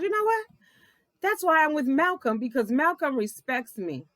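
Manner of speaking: very high mock voice; Sarah's smug reply, very high. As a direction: mimic her smug comeback. Mocking Sarah's comeback.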